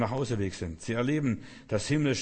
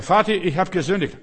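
higher, louder cry of a repentant son; pleading son; 7 semitones higher, 8 dB louder than usual. Acted. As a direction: beg your father for forgiveness. Son voiced begging father. (flehend als reumütiger Sohn)

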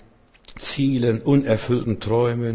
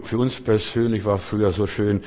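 normal talking voice

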